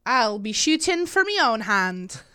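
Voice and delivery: put-on film heroine's Scottish accent; playful put-on Scottish accent; Merida. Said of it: "I want you to do Scottish".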